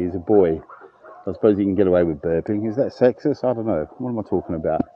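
dropping to a soft mutter to himself; quiet aside. Real, not acted. Dog walker muttering to himself about the dog's burp, quiet aside. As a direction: trail off under his breath, half to yourself.

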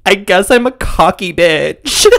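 high, slow, drawn-out fake crying; mock sobbing. Sobbing contestant to girls in the green room, mock tearful. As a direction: imitate a tearful wail, close to laughing. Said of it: "she's, like, sobbing".

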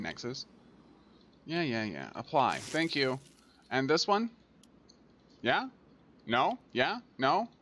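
ordinary delivery

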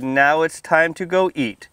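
ordinary delivery

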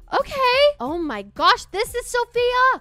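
high, loud thrilled gasp and cry; slow, delighted this is Sophia; excited gasp. Real, not acted. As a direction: gasp in delight at meeting her. Sees the first student.

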